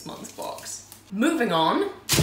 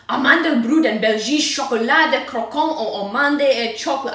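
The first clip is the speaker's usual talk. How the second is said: loud, higher hammed-up French-Belgian accent; muddled foreign accent; 5 semitones higher, 9 dB louder than usual. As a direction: belt out a mock French-Flemish label reading. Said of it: "I don't know what accent or language".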